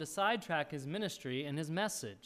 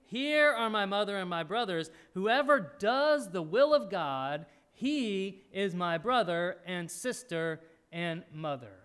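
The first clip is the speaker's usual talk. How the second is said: slow, louder, deliberate weight on every phrase; preacher reading Jesus's answer with slow emphasis; emphatic and slow; 4 dB louder, slower than usual. Retold or imitated. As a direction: let each phrase land heavily. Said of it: "He says it emphatically".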